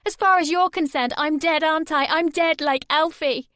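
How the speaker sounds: high, loud shouting rant; furious rant. A narrator's reading. Sammy raging at her mum on the phone. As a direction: hurl every line at her. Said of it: "Sammy shouted".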